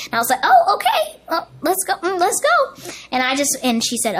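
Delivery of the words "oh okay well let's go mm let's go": high, perky flustered voice; acted startled agreement. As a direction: pitch up and go along with it. Reacting to surprise audition news.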